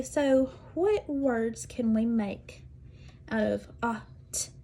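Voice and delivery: slow, drawn-out question to the class; slow teacher question. Retold or imitated. Teacher asking students what words they can make. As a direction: ask it slowly so students can follow. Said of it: "and I'll say".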